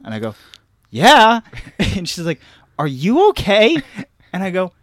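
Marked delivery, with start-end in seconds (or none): 0.9-1.4 high, loud one-word reply; acted kid in the stall; calling back. Kid calls from bathroom stall. 2.8-3.8 high, loud check-in; acted maternal query; imitated mom calling in. Mom calls into the bathroom.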